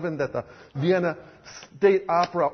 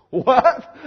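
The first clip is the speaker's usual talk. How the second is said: loud, laughing what; laughing disbelief; 6 semitones higher, 8 dB louder than usual. Acted. Violinist stunned by Jesus's demand, laughing. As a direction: blurt it out in shocked laughter.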